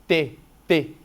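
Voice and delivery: T, T spat out loud; exploding T. Shown as a demonstration. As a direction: hit the T hard. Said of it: "T, também explode na boca". (T explosivo, forte)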